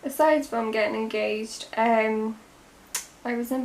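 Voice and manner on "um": usual speaking voice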